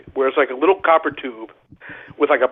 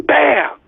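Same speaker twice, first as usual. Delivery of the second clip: loud, high mock explosion "Bam!"; imitated bang; 11 semitones higher, 4 dB louder than usual. The hand blowing up, a loud mock bang. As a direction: burst it out like a blast going off.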